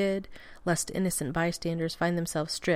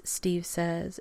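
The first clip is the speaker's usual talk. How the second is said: plain reading voice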